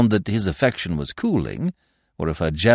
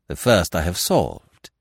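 usual speaking voice

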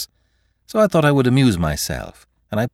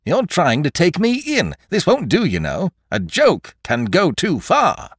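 indignant scolding; 7 semitones higher than usual. A narrator's reading. Magistrate scolds the joking boy.

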